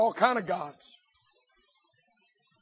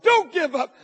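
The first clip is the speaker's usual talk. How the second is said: normal talking voice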